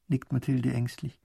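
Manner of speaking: usual speaking voice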